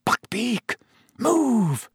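urgent high hiss, held down; hissed urgency. A narrator's reading. Harry urges the stubborn hippogriff on, hissing. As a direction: hiss the order without shouting. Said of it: "Harry hissed".

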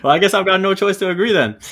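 laughing, high and loud. Real, not acted. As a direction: laugh through the words. Laughing concession to friends.

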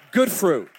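loud punchline; hitting the point hard; emphatic. Real, not acted. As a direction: drive it home loud. Punchline shouted to congregation.

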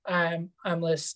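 ordinary talk

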